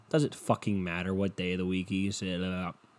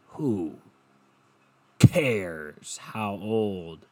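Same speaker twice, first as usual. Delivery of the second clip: ordinary talk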